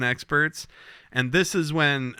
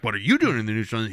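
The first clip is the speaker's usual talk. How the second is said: a hint of a snippy Romulan, fast; fast mock Romulan commander; much faster than usual. Host voicing the Romulan commander to Picard, fast lightly mocking. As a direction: rattle it off, lightly voiced. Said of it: "she's like".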